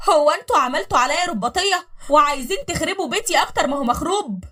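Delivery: loud outburst. Acted. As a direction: shout it back in outrage. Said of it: "وقالت بصوت عالي".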